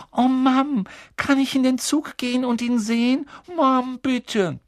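put-on high, loud little girl's plea, begging to see the train; pleading little girl. Little sister begging mom to see Harry, high pleading girl. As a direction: beg like a small girl who wants her way.

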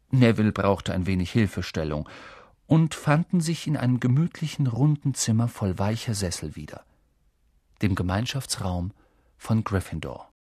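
ordinary delivery

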